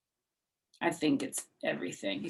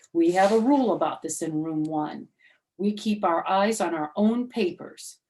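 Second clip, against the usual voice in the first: louder teacher stating a rule; firm teacher; 8 dB louder than usual. Acted. Mister Scary reminding Junie B of the rule, firm. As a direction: lay down the class rule.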